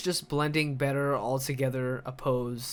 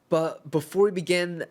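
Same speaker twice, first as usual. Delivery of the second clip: usual speaking voice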